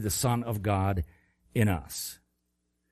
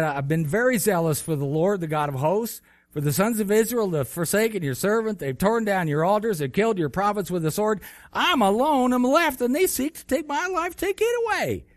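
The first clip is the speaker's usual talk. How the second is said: desperate complaint; 10 semitones higher, 4 dB louder than usual. Acted. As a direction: pitch climbing ever higher into a pleading wail. Elijah complaining to God.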